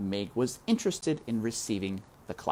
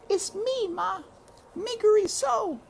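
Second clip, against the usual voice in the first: put-on very high, small child's voice; high little girl answering her mother; pleading little girl; 3 dB louder than usual. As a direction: say your name like a lost child.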